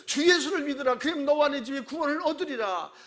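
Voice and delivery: loud high declaration; powerful proclamation. Retold or imitated. Paul declares salvation to the jailer, loud and high. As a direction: proclaim it with full force. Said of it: "이 말을 강하게, 힘있게, 박력있게".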